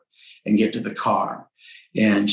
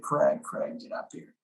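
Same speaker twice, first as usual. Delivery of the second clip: hushed urgent call; 8 dB quieter than usual. Retold or imitated. Wife calling him upstairs.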